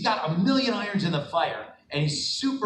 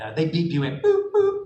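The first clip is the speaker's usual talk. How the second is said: ordinary talk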